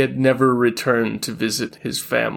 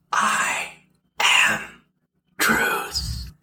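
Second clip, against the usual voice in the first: eerie unvoiced spectral whisper; ghostly whisper; 10 semitones higher, much slower than usual. A narrator's reading. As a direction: breathe it like a phantom. Ghost whispers in his mind.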